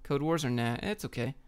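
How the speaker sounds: usual speaking voice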